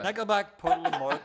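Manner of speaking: high, loud listing of hated bands; mock outrage. Real, not acted. Ranting to friends about bands he hates, loud mock outrage. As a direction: spit the names out in mock disgust.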